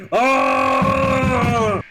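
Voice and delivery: a high, loud shout of Oh. Real, not acted. Friends watching a stream together, loud shout. Reacting to the game moment.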